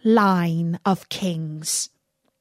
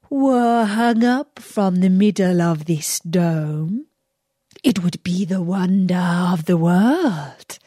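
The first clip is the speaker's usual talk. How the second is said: a lightly voiced sly villain posing as a holy woman; lower, sly, scheming tone; disguised magician voice; 3 semitones lower than usual. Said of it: "replied he".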